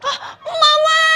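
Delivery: very high, loud drawn-out cry; desperate scream. Acted. Girl taken away calls to mother, desperate scream.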